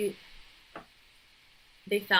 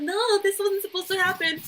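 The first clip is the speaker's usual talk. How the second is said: high, loud hammed-up wail; mock panic; 13 semitones higher, 8 dB louder than usual. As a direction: cry out in exaggerated dismay. Acts out imagined panicked reaction.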